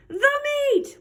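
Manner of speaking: very high, drawn-out crew roar. A narrator's reading. Crew echoes the captain at dinner, roaring. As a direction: echo it as a rowdy crew. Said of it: "we all roared".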